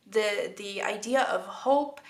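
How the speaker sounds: ordinary talk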